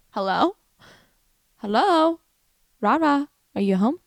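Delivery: calling into an empty house, rising; mock calling out. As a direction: call out questioningly, checking if anyone's home.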